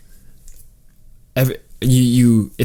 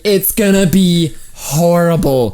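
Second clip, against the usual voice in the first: put-on Southern accent; 5 semitones higher than usual. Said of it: "they don't say it in a Southern accent".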